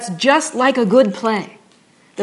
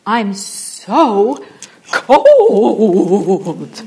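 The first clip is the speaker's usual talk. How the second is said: hammed-up tragic wail, much louder; bad Shakespearean actor; 8 dB louder, much slower than usual. Shown as a demonstration. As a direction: overact the line like a bad stage actor. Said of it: "I might say something like".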